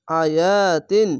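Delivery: drawn-out Quranic chant of আয়াতিন; slow recitation. Shown as a demonstration. Teacher reciting the word for students, slow chant. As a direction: recite আয়াতিন slowly, stretching each vowel. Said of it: "তা দো জের তিন".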